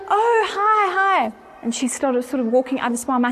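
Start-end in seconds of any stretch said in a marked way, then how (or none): 0.1-1.3 high, drawn-up polite hello; startled greeting. Retold or imitated. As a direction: greet them in a raised, surprised voice. Betsy's retold surprised greeting.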